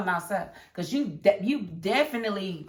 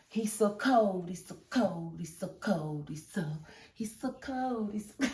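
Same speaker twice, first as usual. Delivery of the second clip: fading to a whisper; 5 dB quieter than usual. Acted. Fan repeats he's so cold, fading to a whisper. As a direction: repeat it, softer each time, down to a whisper.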